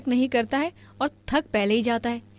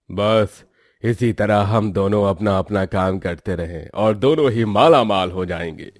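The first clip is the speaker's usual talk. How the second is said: much lower, louder merchant's voice; a rich trader sealing a profitable deal; greedy merchant; 13 semitones lower, 5 dB louder than usual. Acted. Merchant Birju promising shared riches, low greedy voice.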